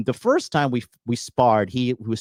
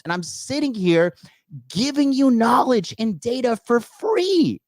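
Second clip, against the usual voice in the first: impassioned preaching; 13 semitones higher than usual. Real, not acted. Preaching his free advice.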